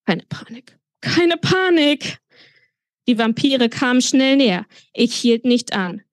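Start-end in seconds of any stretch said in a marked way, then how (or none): 0.1-0.8 quietly trying to stay calm; hushed self-reassurance. A narrator's reading. Narrator steadies himself, first take. 1.0-2.2 high, drawn-out panicky cry; losing composure while urging calm; panicked self-command. A narrator's reading. Same words repeated in rising panic.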